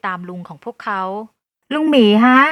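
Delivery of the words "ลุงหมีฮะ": put-on loud, raised child's call. A narrator's reading. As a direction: call your uncle through the door. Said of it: "ต้นข้าวตะโกนเรียก". (ตะโกนเรียก)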